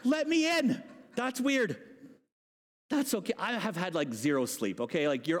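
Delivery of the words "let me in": put-on high British-accented plea. Peter standing outside, pleading in British accent. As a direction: plead like a British orphan, pitched up. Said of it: "he's British in this moment".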